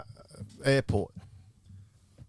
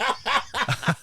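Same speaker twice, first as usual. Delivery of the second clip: a short high burst of laughter; real high laugh; 11 semitones higher than usual. Real, not acted. Storyteller laughing about oblivious Tommy. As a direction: laugh out at the picture.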